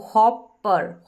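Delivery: हॉपर said slowly, split as हॉप-पर at the double p; slow syllable demo. Shown as a demonstration. Teacher showing the class how to split हॉपर, slow and deliberate. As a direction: break हॉपर in the middle, slowly. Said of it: "तो इस तरीके से".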